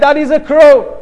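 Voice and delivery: angry. Retold or imitated. Grown son ends scolding his old father, angry. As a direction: drive the answer home as a fed-up son. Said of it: "becomes angry and he says".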